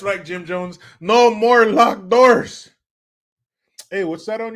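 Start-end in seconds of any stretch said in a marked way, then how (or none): 1.0-2.7 slow, loud proclamation; high, loud rallying shout; shouted catchphrase. Acted. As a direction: belt out each word, drawn out.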